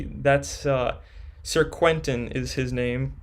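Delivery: normal talking voice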